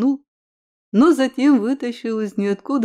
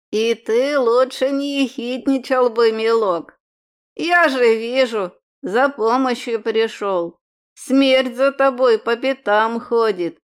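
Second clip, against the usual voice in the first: put-on croaking old crone, slow; elderly breathless. A narrator's reading. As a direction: wheeze it like an old hag. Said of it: "прокряхтела женщина".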